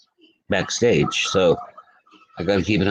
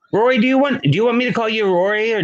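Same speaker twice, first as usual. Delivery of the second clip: much higher, teasing and bright; playful high voice; 12 semitones higher, faster than usual. Real, not acted. Playfully asking Rory's name preference.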